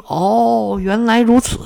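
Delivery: high, drawn-out 'oh'; dawning realisation. A narrator's reading. Nightingale realises after tree's answer.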